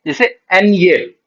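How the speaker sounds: slow एन ये with a light y glide; ñ spoken slowly as एन ये; ñ sound demo. Shown as a demonstration. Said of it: "य की sound आती है".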